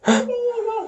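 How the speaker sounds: very high sharp gasp; hammed-up squeaky gasp. Toy figure gasping at the lost weapon.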